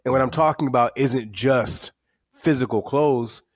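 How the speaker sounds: usual speaking voice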